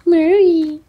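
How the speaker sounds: calling out. Real, not acted. Calling the cat.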